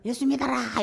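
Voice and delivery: hasty street-preacher shout; mock hurried shout. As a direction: bark it out like a reluctant preacher. Jonah hurrying, shouting gospel.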